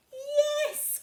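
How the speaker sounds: high bright cry of yes; cried yes. A narrator's reading. Witch welcomes dog aboard, high cry. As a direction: witch gladly agrees, high and loud. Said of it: "cried the witch".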